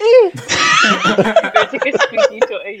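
high squealing laugh through the words; laughing shout. Real, not acted. Laughing at the punch joke.

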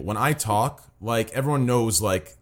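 ordinary talk, nothing marked

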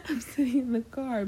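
high, laughing echo of friend's words; laughing disbelief. Retold or imitated. Echoing her late friend's excuse to the listeners, high and laughing. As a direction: repeat it in amused disbelief through a laugh.

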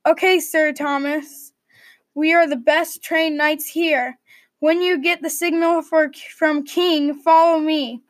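usual speaking voice

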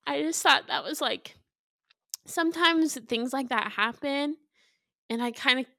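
real tears, voice pushed high and tight; holding back tears while she keeps talking; crying. Real, not acted. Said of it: "Sorry, I'm crying".

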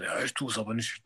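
stubborn refusal breathed out without voice; defiant whisper. Retold or imitated. Woman defiantly whispering to the warden that she won't. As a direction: whisper the refusal like a spiteful child.

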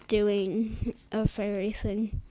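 usual speaking voice

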